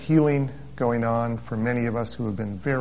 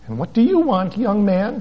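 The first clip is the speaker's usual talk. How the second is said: a higher, brusque healer's voice; 8 semitones higher, 4 dB louder than usual. Retold or imitated. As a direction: snap the question at him. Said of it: "she asked brusquely".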